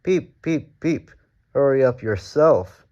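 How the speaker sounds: high, bouncy cheeky reply. A narrator's reading. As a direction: tease him right back. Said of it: "replied Thomas cheekily".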